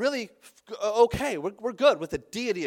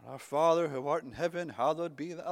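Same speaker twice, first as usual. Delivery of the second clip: mock dignified prayer; 6 semitones lower than usual. Preacher mocking a dignified prayer, mock solemn. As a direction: recite it like a stiff churchgoer. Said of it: "we like to be dignified when we pray".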